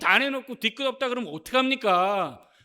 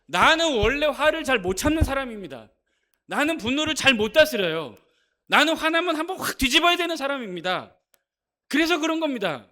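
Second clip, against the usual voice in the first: louder, higher defiant excuse, imitating a hothead; hot-tempered man's excuse; 5 semitones higher, 6 dB louder than usual. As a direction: play the stubborn hothead justifying his outburst. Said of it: "이 사람이 뭐라고 얘기하냐면".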